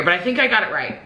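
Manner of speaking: normal talking voice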